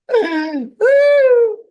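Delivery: high loud woo; excited whoop. Acted. Wine bottle character whoops.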